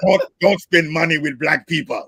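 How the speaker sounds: mock Russian-German accent, lightly put on. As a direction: give the warning in a half-hearted foreign accent.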